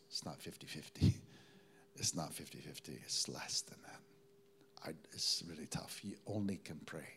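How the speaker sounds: low, hushed whisper of bad news; grim whisper. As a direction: lean in and breathe the warning. Doctor's grim private warning retold.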